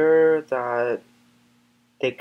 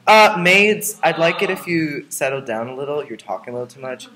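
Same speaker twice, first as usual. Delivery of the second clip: haughty scolding; 3 semitones higher, 8 dB louder than usual. Acted. Scolding the maids off camera.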